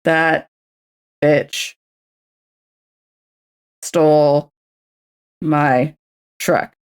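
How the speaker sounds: slow, spaced-out words; slow syllable count. Shown as a demonstration. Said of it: "There we go".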